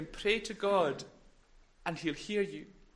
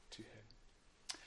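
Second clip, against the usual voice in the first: hushed fade; 9 semitones lower, 25 dB quieter than usual. Real, not acted. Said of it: "fading to silence".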